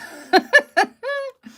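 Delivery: loud high squeal of a laugh; real squealing laugh. Real, not acted. Laughing at her own remark.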